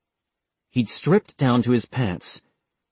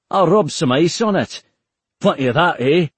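higher, louder breezy Scottish joke; Scottish accent; 6 semitones higher, 4 dB louder than usual. Acted. McLaughlin jokes to Taylor in a breezy Scottish accent. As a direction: shrug it off with Scottish cheer. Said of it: "in a thick Scottish accent".

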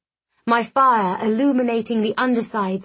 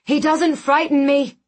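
higher, raised, defiant call; calling over wind; 5 semitones higher, 4 dB louder than usual. A narrator's reading. Narrator defies Korazov in a torrent of wind, calling out. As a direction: push the voice up to carry over wind. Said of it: "my voice almost lost in the torrent of wind".

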